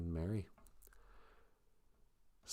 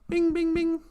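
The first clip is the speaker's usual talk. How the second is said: bell ding imitation; 4 dB louder than usual. Ringing the inn bell.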